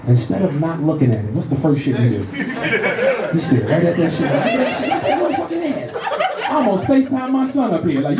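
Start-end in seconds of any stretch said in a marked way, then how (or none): 4.4-5.9 squeaky high gawking; mock gawking. Comic mimicking himself gawking at her hand, squeaky high.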